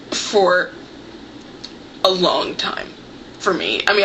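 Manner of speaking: usual speaking voice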